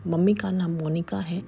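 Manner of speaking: plain reading voice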